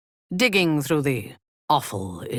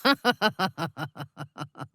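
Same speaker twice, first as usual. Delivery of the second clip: amused chuckle; 2 semitones higher than usual. Acted. Amused laugh.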